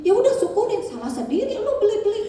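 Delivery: high, laughing mock "serves you right"; a father's hammed-up scolding; mock gloating father. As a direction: tease the child with a laugh. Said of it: "Terus bapaknya begini".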